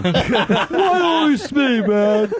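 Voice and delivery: high, slow mock sobbing complaint; mock tearful whine. Host mimics tearful colleague feeling picked on, high mock whine. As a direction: wail self-pityingly, hammed-up. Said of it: "the fucking tears started falling".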